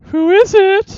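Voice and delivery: high, loud prim English lady; high old lady voice. Acted. Housewife answering a knock, in a high old lady voice. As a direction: play a startled old lady at the door. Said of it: "Role play".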